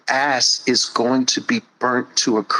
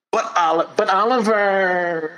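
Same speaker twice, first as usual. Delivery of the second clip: high, pleading whine; mock protest, high and nasal; whiny excuse; 7 semitones higher than usual. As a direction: whine like a stalling child. Mocking the buts.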